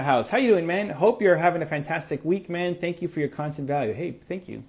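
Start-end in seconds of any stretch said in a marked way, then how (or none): none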